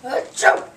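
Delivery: sharp breathy sneeze; loud sneeze. Acted. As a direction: sneeze it out hard. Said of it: "he sneezes again".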